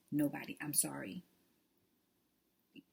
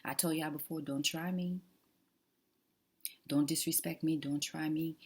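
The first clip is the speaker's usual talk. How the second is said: ordinary talk, nothing marked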